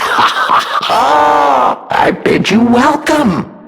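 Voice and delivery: slow, high, laughing grand welcome; theatrical host; mock welcome. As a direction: drag out the welcome with a giggle. Mocking welcome to the guest.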